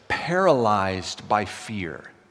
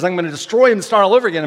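wrathful, louder and much faster; acted burst of wrath, raised and rushed; God; 5 semitones higher, 7 dB louder, much faster than usual. God threatens his people, wrathful outburst. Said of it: "God in his wrath says".

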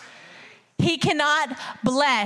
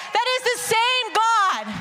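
preaching shout; 9 semitones higher, slower than usual. Real, not acted.

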